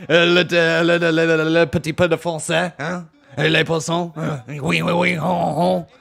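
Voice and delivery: hammed-up French babble; mock French. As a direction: babble in a put-on French accent. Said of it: "I gotta try French".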